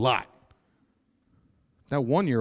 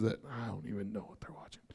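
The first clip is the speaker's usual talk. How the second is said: fast, whispered, much softer; whispered aside; 4 semitones lower, 15 dB quieter, faster than usual. Real, not acted. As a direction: tuck it in under your breath. Quick aside about the kids' shows.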